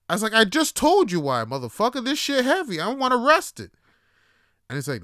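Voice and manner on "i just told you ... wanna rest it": loud, high exasperated comeback; indignant retort. Retold or imitated. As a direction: retell it as a heated outburst. Retold quarrel over a bus seat.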